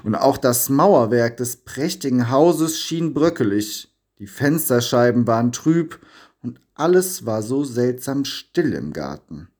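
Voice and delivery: ordinary delivery, nothing marked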